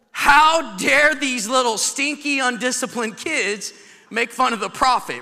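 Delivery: loud, high indignation; slow, punched opening, appalled and loud; mock outrage. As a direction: scold in hammed-up righteous fury. Acted outrage at the mocking boys.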